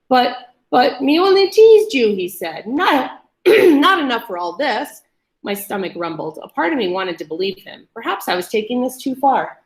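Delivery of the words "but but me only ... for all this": higher, louder stammer; stammering plea. A narrator's reading. Captive insisting he only teased, pleading. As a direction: plead weakly.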